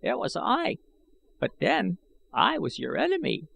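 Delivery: an ancient schemer speaking up; old man I Gos. A narrator's reading. Old man warning Gahan from the shadows, high and aged.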